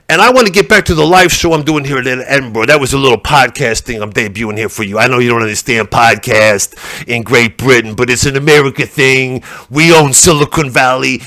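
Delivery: ordinary delivery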